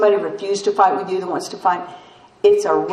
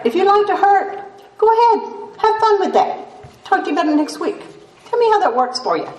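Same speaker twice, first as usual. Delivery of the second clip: much higher, sing-song dismissive man; breezy sponsor retort; 12 semitones higher than usual. Retold or imitated. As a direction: toss it off lightly.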